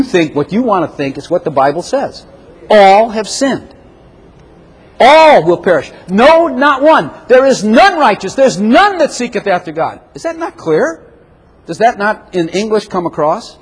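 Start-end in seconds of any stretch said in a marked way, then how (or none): 2.7-11.1 slow, high, hammering scripture; preaching at full force. Acted. Preacher shaking up the congregation with scripture, slow and forceful. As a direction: pound out each verse, slowly.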